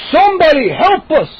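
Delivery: high, loud plea for help; desperate call. Acted. Moose shouting for help while chased. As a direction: shout to wake the house.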